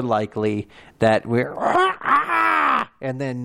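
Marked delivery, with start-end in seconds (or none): 1.6-3.0 high crow-like dragon screech. Pretend. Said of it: "that's my dragon voice".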